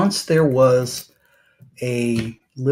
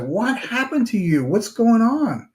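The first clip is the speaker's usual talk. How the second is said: higher, alarmed concern for a hurt friend; shocked meditator; 9 semitones higher than usual. Acted. Meditator finding the beaten snake, higher alarmed surprise. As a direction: gasp it out in worried surprise. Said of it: "And the meditator's like".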